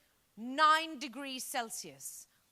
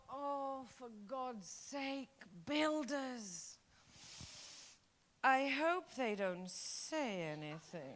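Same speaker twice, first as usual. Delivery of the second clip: inner thought; 5 dB quieter, much slower than usual. Retold or imitated. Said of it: "I thought".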